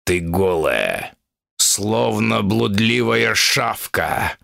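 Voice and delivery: menacing bull demon. Acted. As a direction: growl it like a furious bull.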